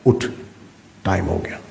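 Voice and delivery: low, soft prod to get up; hushed, low voice of conscience; inner voice. Acted. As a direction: quietly voice a nudge from within. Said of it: "پہلی آواز اندر سے آئی".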